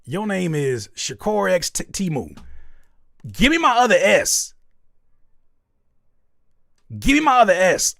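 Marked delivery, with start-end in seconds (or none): 3.3-8.0 loud, high playful demand; hammed-up indignation, higher and louder; mock fury over a missing letter; mock outraged demand. Mock outrage at misspelled name.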